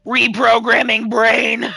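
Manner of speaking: slow, mock machine announcement; menacing AI. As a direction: announce it like an AI taking over. Playing the AI taking over.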